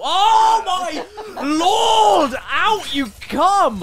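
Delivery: frantic shout. Real, not acted.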